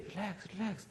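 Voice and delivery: hushed, soothing repeat; gently imitating a doctor; soft soothing relax. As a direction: murmur it like a calm doctor. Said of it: "they say like this".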